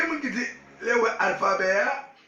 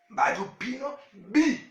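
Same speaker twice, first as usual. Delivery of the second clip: usual speaking voice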